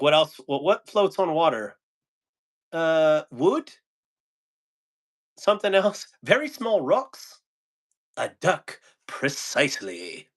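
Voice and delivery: lightly voiced villagers guessing, high; witch scene quote. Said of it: "And they're like".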